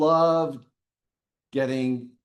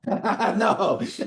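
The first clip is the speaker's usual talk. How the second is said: laughing; 7 semitones higher, 3 dB louder, slower than usual. Real, not acted. Laughs at the anti-vax question.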